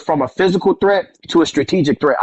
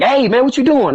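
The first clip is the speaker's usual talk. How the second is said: higher-pitched mock yell; imitated angry yell; 7 semitones higher than usual. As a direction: mildly act out an annoyed granddad's yell. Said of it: "he got mad and he yelled at me".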